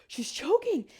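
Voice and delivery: gasp, then a high cry of alarm. Retold or imitated. Woman looking over and seeing the speaker choke, alarmed. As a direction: imitate a shocked bystander raising the alarm.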